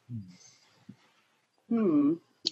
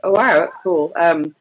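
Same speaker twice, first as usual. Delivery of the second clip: normal talking voice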